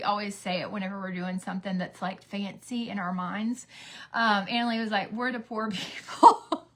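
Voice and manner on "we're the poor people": breaking into laughter mid-line; amused quote that collapses into a laugh; chuckling retold joke. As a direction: drag it out and crack up laughing. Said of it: "We just had a good chuckle at it".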